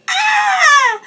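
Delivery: high, loud cub screech; baby bear screeching in outrage. A narrator's reading. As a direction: let out a shrill little cry. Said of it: "Baby Bear screeched".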